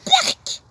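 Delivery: high loud yuck; disgusted cry. Acted. Martin crying out in disgust at the slime. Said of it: "crie Martin".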